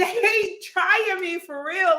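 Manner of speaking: high, slow, disbelieving outrage; hammed-up heated indignation, slow and high; mock furious. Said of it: "I was furious".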